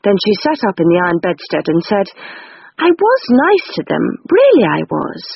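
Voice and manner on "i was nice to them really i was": put-on higher girlish voice, defensive; insist, hurt and pitched up; hurt sister pleading, higher voice; protesting Rose. A narrator's reading.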